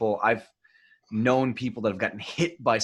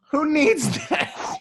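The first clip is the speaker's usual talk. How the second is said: much higher, slow disbelief; incredulous; 14 semitones higher, much slower than usual. Real, not acted. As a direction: drag it out in shock. Incredulous at the size.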